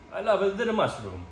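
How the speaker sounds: a deliberately bad Italian accent. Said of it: "That was a really bad Italian accent".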